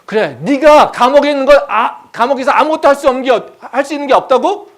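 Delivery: loud, high rhetorical question; heated rebuke rising at the end; incredulous challenge. Acted. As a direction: throw the despair back at them, shouting. God challenges the prisoner's despair.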